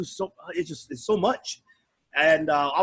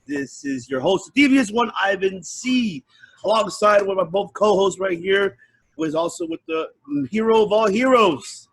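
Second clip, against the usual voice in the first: booming hype host intro; big ring announcer build-up; 4 semitones higher, 4 dB louder than usual. Acted. Hypes the podcast intro.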